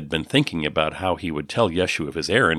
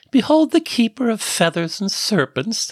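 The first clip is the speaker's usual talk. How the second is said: much higher, grand mock herald announcement; playful grand proclamation; mock ostentation; 3 dB louder than usual. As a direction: puff it up like a pompous crier, tongue in cheek. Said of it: "announced Miri with mock ostentation".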